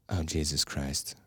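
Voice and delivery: low, soft, slow groaned oh Jesus Christ; groaning dismay. Real, not acted. Host dreading an eight-hour pick, low weary groan. Seeing next pick is Zeitgeist.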